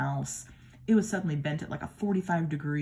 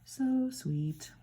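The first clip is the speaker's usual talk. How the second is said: cooing; 9 semitones higher than usual. Real, not acted. Admiring the card.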